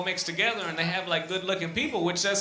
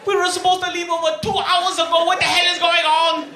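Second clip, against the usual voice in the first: high, loud, frantic yelling; frantic terrorist character; 14 semitones higher, 7 dB louder than usual. Acted. Comic's irate hijacker at the airline counter, frantic high yelling. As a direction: yell it high and hard, an outraged caricature passenger. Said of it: "Ahmed, the terrorist, would have been running up".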